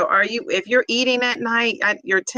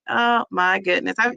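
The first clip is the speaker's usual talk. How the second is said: usual speaking voice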